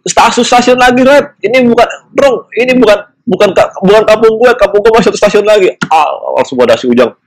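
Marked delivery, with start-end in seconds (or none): 0.0-5.7 panicked. Retold or imitated. Panicked realising wrong station.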